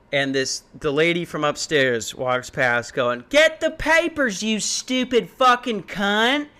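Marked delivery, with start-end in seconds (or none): 3.3-6.5 high, drawn-out mock yell; imitated yelling. Upstairs lady cursing her sister on the way past, mock yelling. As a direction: mimic a woman yelling insults, pitched up and slowed. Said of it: "her sister yells".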